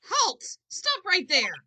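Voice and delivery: high, loud order to halt; commanding shout. Acted. Lawyer's partner stopping the caretaker, commanding shout. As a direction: shout to stop someone leaving. Calling out to stop thief.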